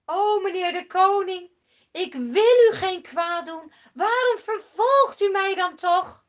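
loud, high pleading shout; David calling to King Saul. Acted. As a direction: plead across the distance with the king.

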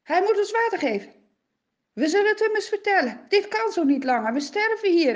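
men telling wives they'll confront Moses, angry. Acted. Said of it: "Ze staan op en ze zeggen".

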